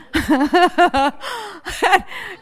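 high burst of laughter; hearty laughter. Real, not acted. Preacher recalls the five o'clock clock, bursting out laughing. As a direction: laugh helplessly at the memory.

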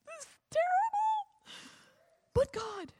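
high, wailing mock lament; mock crying. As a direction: whimper it like someone crying. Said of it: "we can be crying going".

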